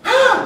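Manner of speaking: very high, loud squeal of joy; sudden high excited yelp; delighted child's squeal. Retold or imitated. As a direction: squeal like a kid promised ice cream. Child's delight at the ice cream.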